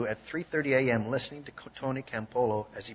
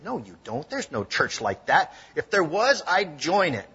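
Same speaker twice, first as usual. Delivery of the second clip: lightly mocking, louder scoff; 4 semitones higher, 6 dB louder than usual. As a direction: scoff at the idea, half teasing. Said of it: "in a mocking way said".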